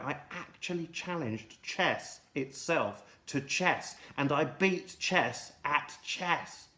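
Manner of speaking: slow, drawn-out bragging; milk the brag slowly; slow boast. Acted.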